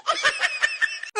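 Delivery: mocking laugh at the daughter-in-law. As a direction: cackle at her.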